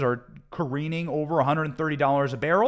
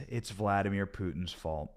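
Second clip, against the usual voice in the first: ordinary talk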